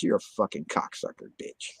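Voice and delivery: near-whispered silent insult; hushed, voiceless cursing, as if only thought; said in head. Retold or imitated. Recalling what he thought at the cop, whispered inner insult. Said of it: "In my head, you know".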